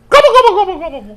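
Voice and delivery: high-pitched turkey noises, loud then fading; turkey gobble. Retold or imitated. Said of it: "I'm not gonna, like".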